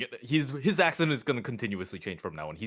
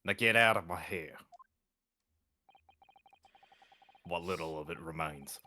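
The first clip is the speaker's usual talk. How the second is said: put-on southern accent; 6 semitones lower, 4 dB quieter than usual. Guard sending visitors away, southern accent. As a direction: drop down into a dry southern accent. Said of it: "give it a southern accent".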